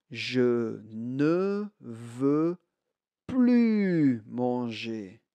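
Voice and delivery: very slow, drawn out, stress on ne and plus; slow model sentence. Shown as a demonstration. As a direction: stretch it out word by word for learners.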